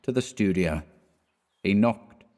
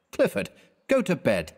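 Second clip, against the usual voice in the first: urging friend to bed; 8 semitones higher than usual. A narrator's reading. Hastings scolding drunk friend.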